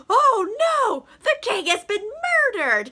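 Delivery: high, loud cry of discovery; hammed-up shock, easing down from a peak; mock horror. Nobles discover the king's body.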